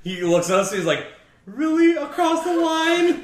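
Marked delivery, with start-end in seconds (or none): 1.5-3.2 much higher, slow, laughing disbelief; mock-innocent. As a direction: squeak it out in fake innocence. Mark's mock-innocent reply.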